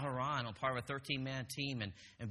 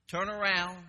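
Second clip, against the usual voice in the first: raised, loud order; drill instructor command; 5 semitones higher, 6 dB louder, slower than usual. Retold or imitated. Drill instructor orders recruits to their lockers, barking. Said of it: "drill instructor's still yelling at you".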